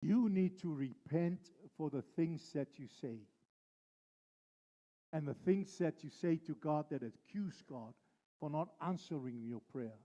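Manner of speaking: usual speaking voice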